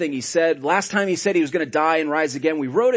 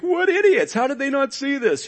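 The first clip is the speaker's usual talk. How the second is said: scoffing; 11 semitones higher than usual. Pretend. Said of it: "I can look and be like".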